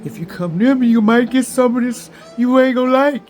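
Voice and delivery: spiritual team warning. Retold or imitated. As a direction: voice the spirits rising into a loud threat. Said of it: "my spiritual team says".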